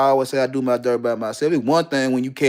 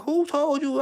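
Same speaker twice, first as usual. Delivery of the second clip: surprised; 14 semitones higher, 3 dB quieter, slower than usual. Retold or imitated. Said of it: "I'm like".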